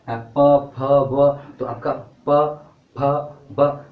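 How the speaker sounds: प, फ, ब pushed out loud and hard from the lips; loudly sounding प फ ब to show the lips at work; lip sounds demo. Shown as a demonstration. Teacher to students, voicing प फ ब loudly with the lips. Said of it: "इन केवल ओठों का ही प्रयोग".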